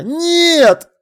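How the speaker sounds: loud, high repeated denial; desperate yell of protest; shouting. A narrator's reading. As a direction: insist at the top of the voice. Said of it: "закричал я".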